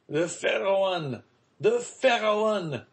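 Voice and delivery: high, drawn-out cries from the pier; crowd cheering. A narrator's reading. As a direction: call it out like an excited crowd. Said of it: "said every voice".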